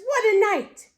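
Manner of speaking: loud, high mole exclaiming about the storm; hammed-up mole, loud not muttered; mole muttering. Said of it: "Mole mutters".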